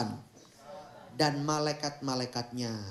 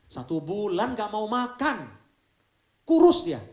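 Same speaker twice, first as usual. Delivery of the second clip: excited; 7 semitones higher, 9 dB louder than usual. Real, not acted. Marvelling at the pastor's fast.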